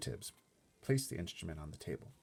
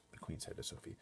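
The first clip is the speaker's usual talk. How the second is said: ordinary delivery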